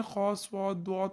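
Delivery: ordinary talk, nothing marked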